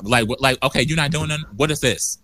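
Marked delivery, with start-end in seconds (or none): none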